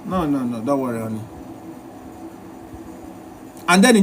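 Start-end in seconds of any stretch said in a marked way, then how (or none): 0.0-1.2 much lower and softer brush-off; lowered, quiet "don't worry, honey"; husband's soft dismissal. Retold or imitated. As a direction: wave her off gently and tiredly. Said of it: "he told you".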